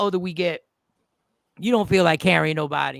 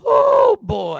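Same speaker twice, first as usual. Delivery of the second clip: shocked shout; 13 semitones higher, 7 dB louder than usual. Real, not acted. Host reacting to a guest's clip, shocked yelp. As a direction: blurt it out in disbelief.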